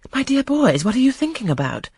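high, shocked mother; Mrs. Lynn scolding her sons, high and shocked; scandalised exclamation. A narrator's reading. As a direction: scold the boys in dismay. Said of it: "exclaimed Mrs. Lynn".

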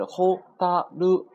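ホタル in three separate, held beats; kana by kana. Shown as a demonstration. Teacher says it has three kana, ホタル syllable by syllable. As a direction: spell out ホ, タ, ル one at a time. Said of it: "三个假名组成".

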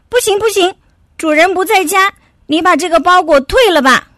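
high, impatient little dog voice; impatient refusal. Acted.

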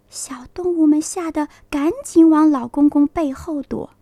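ordinary delivery

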